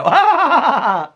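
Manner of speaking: high, loud fake cackle; mocking laugh back. Answering Alex's fake laugh, mock cackle. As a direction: return the exaggerated laugh, amused. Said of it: "And I go".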